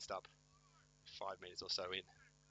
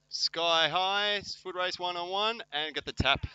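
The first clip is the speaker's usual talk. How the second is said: raised, loud sporting call; excited play-by-play; 8 semitones higher, 8 dB louder, slower than usual. Real, not acted. Commentator calls a one-on-one foot race, excited play-by-play. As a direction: ride the rising action as it happens.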